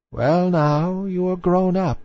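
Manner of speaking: put-on grand old lady addressing her granddaughter; old dowager voice. A narrator's reading. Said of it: "said the old dowager, her grandmother".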